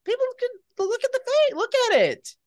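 hammed-up vanity. Joking about the actor's head.

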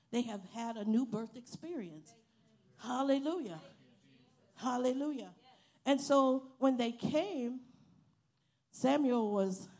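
loud, fervent pulpit cry; fervent preaching. Real, not acted. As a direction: preach it out, full voice. Preaching at full force.

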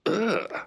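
loud, high groan of revulsion; sharp noise of disgust; disgusted ugh. Acted. Disgust at an indecent offer.

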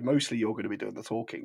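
normal talking voice

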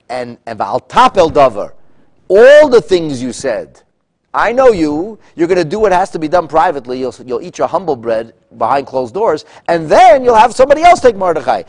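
a king furiously dressing down his minister; loud and high, hammering each word at Haman; loud scolding king. Acted. King berating Haman, acted quote.

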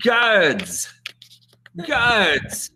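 calling out. Acted. Prisoner to Klingon guards to open the door, calling out. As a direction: yell it high as a dramatic prisoner.

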